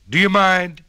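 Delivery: loud, sharp complaint; annoyed donkey. A narrator's reading. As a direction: snap at the noisy crowd. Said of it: "said the donkey with a he and a haw".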